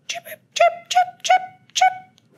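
tiny, very high repeated chirps; high animal chirps. A narrator's reading. Unseen bat chirps outside the shelter in the rain, squeakily. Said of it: "came an unfamiliar sound".

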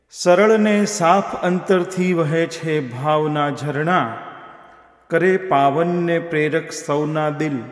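slow, measured verse with drawn-out words; poetry recitation. Acted. Host reciting a tribute muktak for the poet, slow poetic recitation.

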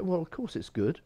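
ordinary talk, nothing marked

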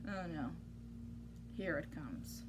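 low, soft dreading murmur. Real, not acted. Reader dreading the silly sounds ahead. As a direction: brace yourself quietly.

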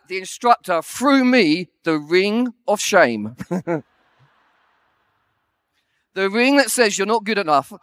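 usual speaking voice

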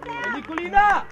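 loud shout of a name; real sideline yell; calling out. Real, not acted. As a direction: yell to a player across the field. Coach calling a player.

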